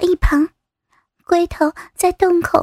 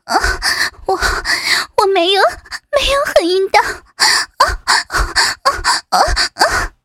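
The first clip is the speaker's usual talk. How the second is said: gasping denial; 2 semitones higher, faster than usual. Acted. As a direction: protest between moans. Breathless denial in acted scene.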